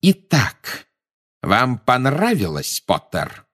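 slow, threatening, falling at the end; contained fury, deliberate delivery; menacing interrogation. A narrator's reading. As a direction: toy with the culprit, drawing words out. Enraged Snape interrogates Harry.

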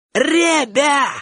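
high-pitched aggrieved call; complaining, irritated shout. A narrator's reading. Bocho complains to the boys who let him down, shouting. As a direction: call out to the gang, whining and annoyed. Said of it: "крикнул Бочо, как бы отчасти жалуясь и раздражаясь".

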